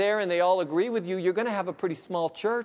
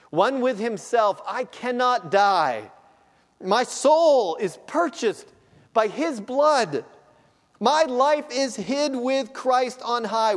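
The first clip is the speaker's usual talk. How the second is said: slow, loud and high, soaring through the words; impassioned preaching; 7 semitones higher, 7 dB louder, slower than usual. Acted. Preacher to congregation, slow soaring proclamation. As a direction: proclaim it like a triumph.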